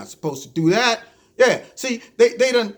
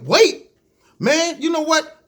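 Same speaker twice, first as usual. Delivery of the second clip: indignant outburst, pitched up and louder; mock frustrated; 8 semitones higher, 4 dB louder, slower than usual. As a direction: throw the word back in exasperation. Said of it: "I'm frustrated".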